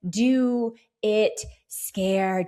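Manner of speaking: very slow, stretched affirmation; emphatic motivational mantra. Shown as a demonstration. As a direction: lay each word down deliberately. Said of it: "the other mantra".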